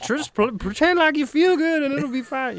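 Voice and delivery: high, laughing through forced calm; laughing mock panic. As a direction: fake composure cracking into laughter. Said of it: "It's like".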